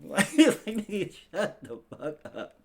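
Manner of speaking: loud, slow laughing dismissal; laughing shut up. Real, not acted. Podcaster laughing at the liars, loud slow. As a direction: laugh it off in disbelief.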